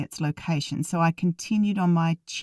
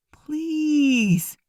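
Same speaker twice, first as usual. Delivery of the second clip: begging; 9 semitones higher than usual. A narrator's reading. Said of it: "I begged".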